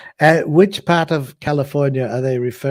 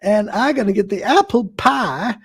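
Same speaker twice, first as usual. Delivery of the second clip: put-on hillbilly accent; 7 semitones higher than usual. Showing co-hosts his practised hillbilly accent, high and drawled. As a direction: ham up a Southern hillbilly. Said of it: "let's hear your accent".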